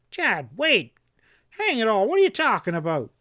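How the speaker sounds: high, fast, frightened cry; terrified cry. A narrator's reading. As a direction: cry out in terror. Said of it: "Epp cried out in a terrified voice".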